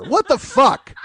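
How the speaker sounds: ordinary delivery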